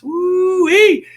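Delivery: high, loud excited whoop; whooping woo-wee. Real, not acted. Whooping at a quarterback controversy, high and loud. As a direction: let out a delighted whoop.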